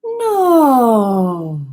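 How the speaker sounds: No starting high and sliding down without growl; high to low glide. Shown as a demonstration. Voice coach demonstrating a high to low glide on No. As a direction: slide the word No down from the top, stop before the fry. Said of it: "So it'll sound like".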